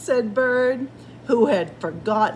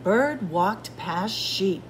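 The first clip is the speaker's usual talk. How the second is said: ordinary delivery, nothing marked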